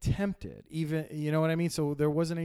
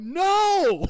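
loud, high mock shout; shouting back; 11 dB louder than usual. Dad shouts back from outside her room, shouting. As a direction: holler it back at the kid. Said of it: "And I shout from her back".

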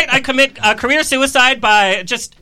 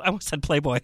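quiet aside; 7 semitones lower, 8 dB quieter, faster than usual. Real, not acted. Aside correcting his slip.

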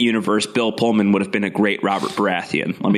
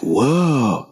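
stunned surfer-dude whoa; lightly voiced Keanu, dazed. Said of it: "very Keanu about it all".

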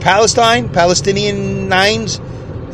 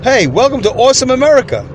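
higher-pitched, lightly acted greeter; welcoming greeter; 7 semitones higher than usual. Guy greets immigrant off the boat, welcoming. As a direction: call out a mock welcome. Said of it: "some guy greets you and like".

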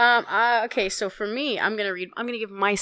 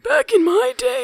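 put-on old man voice; 13 semitones higher than usual. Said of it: "That's my thing".

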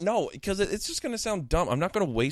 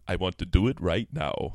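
low, hammed-up seductive voice; mock-sultry and low; sexy voice; 5 semitones lower than usual. Shown as a demonstration. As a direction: say it deep, as a come-on. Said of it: "this is my sexy voice".

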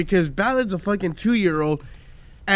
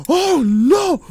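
put-on high, louder panicked child voice; scared kid; 10 semitones higher, 6 dB louder, much slower than usual. The son on the ride, high panicked squeal. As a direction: squeal it like a terrified toddler. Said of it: "He's like".

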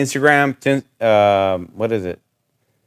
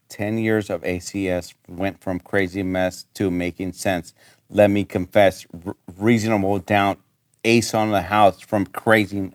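softer, lower mumbled rap read; quiet recital; 7 semitones lower, 8 dB quieter than usual. Real, not acted. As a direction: recite the lines quietly, low. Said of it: "you had this written out".

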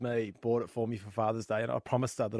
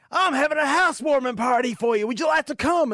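cowboy voice; 6 dB louder than usual. Acted. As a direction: play the friendly cowboy neighbour big. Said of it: "There's a cowboy there".

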